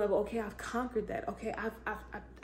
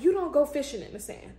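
louder, punched out first try; emphatic; 4 semitones higher, 7 dB louder than usual. Real, not acted. Preacher pressing her point with emphasis. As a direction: drive the point home hard. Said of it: "let me put my".

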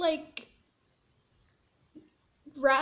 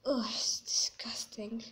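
whispering; 6 semitones lower, 5 dB quieter than usual. Real, not acted. Disgust inside octopus.

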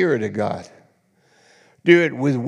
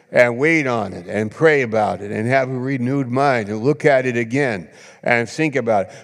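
ordinary talk, nothing marked

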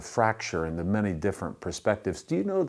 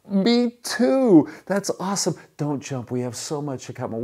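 loud, very high burst settling into eager pleading; hammed-up joy, exploding then easing down; overjoyed; 9 semitones higher, 6 dB louder than usual. Rescuer learns both are Northern Baptist, overjoyed.

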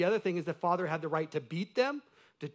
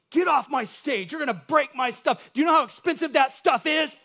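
loud, high, furious pastor's shout; angry yell; 9 semitones higher, 9 dB louder than usual. Retold or imitated. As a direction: bark it at kids like an angry pastor. Pastor yelling at kids on stage.

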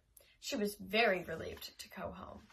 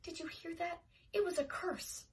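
tearful; 6 semitones higher, 3 dB quieter than usual. A narrator's reading. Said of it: "greeted Mary Ann tearfully".

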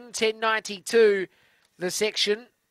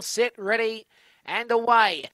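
race commentary; 3 semitones higher, 4 dB louder than usual. Acted. Live greyhound race call.

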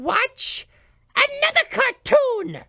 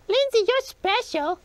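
usual speaking voice

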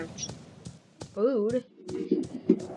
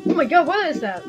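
panicked, voice pitched up; scared horror game streamer; 6 semitones higher, faster than usual. Real, not acted. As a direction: flee in real fright. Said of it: "Chocolate Bonnie is scared".

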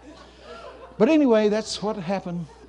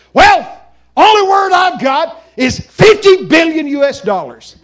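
loud, high, exasperated shout; retold angry outburst; mad; 10 semitones higher, 10 dB louder than usual. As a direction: yell it back angrily. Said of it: "I'm mad".

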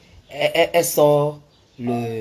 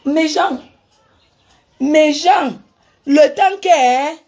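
much higher, loud excited exclamation; excited outcry; 12 semitones higher, 7 dB louder, slower than usual. Real, not acted. Telling viewers how the woman jumped on her, excited loud outcry. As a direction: call out to the audience, worked up.

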